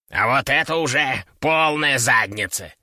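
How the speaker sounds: suddenly grave parrot voice; serious parrot. A narrator's reading. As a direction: drop the jokes, dead serious. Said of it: "мгновенно посерьёзнев".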